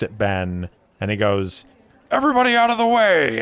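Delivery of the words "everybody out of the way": high, fast, booming shout; excited shout. Acted. Brewer shoving past the crowd to greet his friend, high excited shout. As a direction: clear the crowd with a big high yell.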